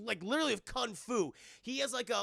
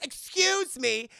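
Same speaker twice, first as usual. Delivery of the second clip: high, loud, drawn-out mock offence; hammed-up affronted heroine; offended; 5 semitones higher, 6 dB louder, slower than usual. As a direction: stretch it out in indignation. Acts offended heroine's retort.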